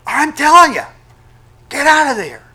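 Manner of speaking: high, loud urgent command; God yelling through Scripture at a believer, loud and urgent. Acted. Shout the warning so they move now. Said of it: "God is gonna be yelling at you".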